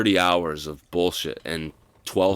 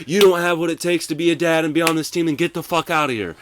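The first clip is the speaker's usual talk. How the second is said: much higher and louder, fast, berating; imitating a screaming coach; 10 semitones higher, 8 dB louder, faster than usual. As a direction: tear into the player like an enraged coach.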